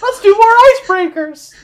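high and loud, pushing the small talk. Acted. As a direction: chirp it out brightly and loudly. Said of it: "This is in giant".

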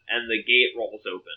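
ordinary delivery

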